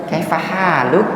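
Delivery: logat Sunda, "pahaluk" diucapkan dengan bunyi ala Sunda; Sundanese-style Arabic. Retold or imitated. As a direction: tiru logat Sunda saat bilang "pahaluk". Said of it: "Kalau antum lagi ke Sunda gimana". (logat Sunda, "Kayak pahaluk" ala orang Sunda)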